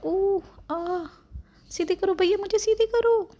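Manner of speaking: put-on very high, squeaky baby whine; whiny baby. Baby on mother's lap begging brother to straighten her, high whine. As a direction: plead like a baby whose head is slipping. Baby whining, head tilting.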